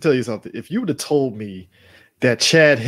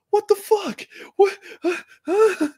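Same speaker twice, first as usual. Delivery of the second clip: much higher squeal of disbelief; mock shocked fans. Buffalo fans react to the loss, high disbelief. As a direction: shriek like stunned fans.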